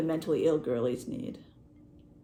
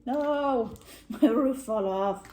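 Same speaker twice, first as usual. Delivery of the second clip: loud, high wail of dismay; drawn-out comic distress; playful dismay; 6 semitones higher, 6 dB louder, much slower than usual. Real, not acted. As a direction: cry out in half-laughing despair. Roof of gingerbread house falls.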